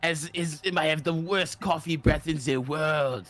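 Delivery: hammed-up French caricature; mock French accent. Mocking the French with cigarettes and pie, caricature French accent. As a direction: put on a thick, silly French accent. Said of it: "I am French".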